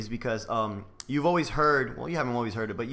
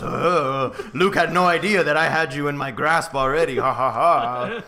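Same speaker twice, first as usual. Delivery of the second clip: hammy villain gloating; lightly voiced cartoon villain; mustache twirling villain; 4 dB louder than usual. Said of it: "Twist, twist his mustache".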